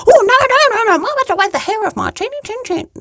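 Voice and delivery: high, loud squealing refusal; panicked little pig. Acted. Third pig squeals no at the wolf, high. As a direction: squeal no in alarm.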